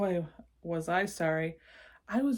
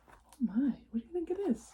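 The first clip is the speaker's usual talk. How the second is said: fast, higher whisper; 8 semitones higher, faster than usual. Real, not acted. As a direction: whisper the question with wonder. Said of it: "whispered question to listener".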